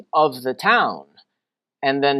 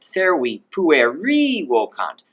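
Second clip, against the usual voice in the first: "pueri" rising high on a long final syllable; slow Latin, swoop up on the end of "pueri"; high stressed genitive. Shown as a demonstration. Teacher modelling for students, "pueri" high and held at the end. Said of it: "this is how you're gonna say it".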